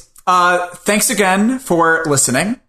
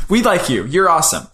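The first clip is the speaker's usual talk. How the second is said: ordinary talk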